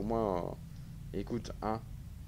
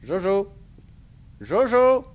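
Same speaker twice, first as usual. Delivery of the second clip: high loud call; 6 semitones higher, 8 dB louder, slower than usual. Pretend. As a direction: shout the name out. Calling out to character.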